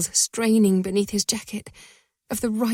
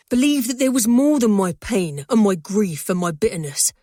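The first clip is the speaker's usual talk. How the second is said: impassioned; 4 dB louder than usual. A narrator's reading. His impassioned confession.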